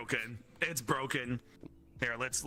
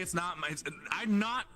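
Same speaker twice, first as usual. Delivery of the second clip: ordinary talk, nothing marked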